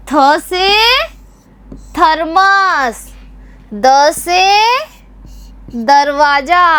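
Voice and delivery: put-on slow, drawn-out recitation for children; recitation drill. Shown as a demonstration. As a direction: call out each letter and word clearly for the class to repeat. Teacher leads consonant recitation drill. (बच्चों के लिए धीमा, खींचकर पाठ)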